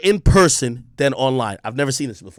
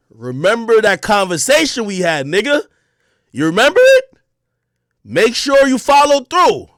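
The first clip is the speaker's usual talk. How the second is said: raised, insistent warning, slowing at the end; pointed reminder; 5 semitones higher, 4 dB louder than usual. Retold or imitated. Man grabbing Joe by the shoulder, pointedly reminding him. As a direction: press each phrase as a veiled warning. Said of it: "it doesn't look really threatening".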